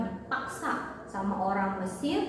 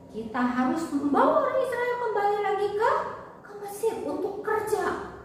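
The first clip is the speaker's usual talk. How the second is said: angry Pharaoh; 9 semitones higher than usual. Acted. Angry Pharaoh ordering his army after Israel, commanding. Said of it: "Raja Mesir marah".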